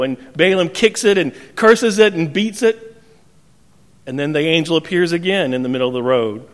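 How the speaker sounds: usual speaking voice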